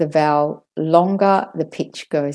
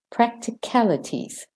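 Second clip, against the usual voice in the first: "Practicalities" higher, softer, falling after "cal"; sing-song lift on "cal" in "Practicalities"; word melody; 4 semitones higher, 5 dB quieter than usual. Shown as a demonstration. Teacher has learners hear the melody, "Practicalities" lifted then falling. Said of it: "Listen to the melody".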